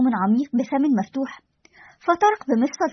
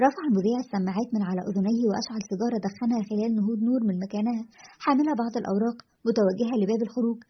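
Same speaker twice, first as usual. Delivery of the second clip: usual speaking voice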